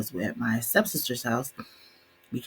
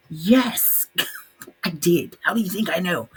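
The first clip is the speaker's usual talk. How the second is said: laughing exasperation; 6 semitones higher, 5 dB louder than usual. Real, not acted. Exasperated reply to dad.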